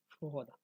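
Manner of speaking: সুহদা as 'su-oda', in three syllables; Sanskrit pronunciation. Shown as a demonstration. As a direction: say সুহদা with 'su' gliding into 'oda'. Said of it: "তাহলে উচ্চারণটা কি হবে?". (সুহদা, সংস্কৃত উচ্চারণে)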